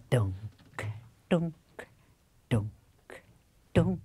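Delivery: imitated drumbeat. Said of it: "begynder at spille".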